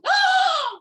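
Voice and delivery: sharp, very high loud gasp; high offended gasp; shocked gasp. Acted. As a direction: gasp in outrage. Sister insulted, gasps.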